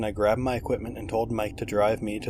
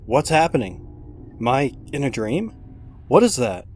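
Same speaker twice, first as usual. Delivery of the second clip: panicked; 5 semitones higher, 5 dB louder than usual. Acted. Hiding from monster.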